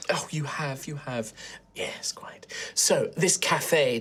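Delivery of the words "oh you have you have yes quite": a soft breathy whisper, barely voiced; hushed whisper. The sister whispers agreement to her companion, hushed. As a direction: keep it to a whisper so no one overhears.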